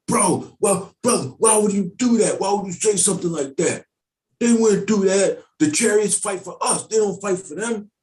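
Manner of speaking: high, pressed voice of an offended churchgoer; squeaky outraged fellow believer defending the chariots; indignant believer. Acted. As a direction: scold him like a shocked brother in the pews. Mock rebuke of a doubting brother.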